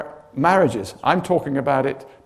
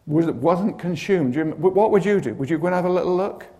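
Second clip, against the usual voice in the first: normal talking voice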